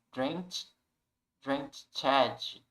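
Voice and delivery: "drenched" with an added "ed" syllable, "drench-edi"; mispronounced drenched. Shown as a demonstration. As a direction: voice the ending of "drenched" as its own syllable. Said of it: "A gente não vai falar". ("drenched" errado, com "édi" no final)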